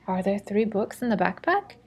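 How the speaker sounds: yes-or-no question that lifts on "backpack"; rising question intonation. Shown as a demonstration. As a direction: ask it with a clear rise at the end of "backpack". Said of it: "this rising intonation for the yes or no".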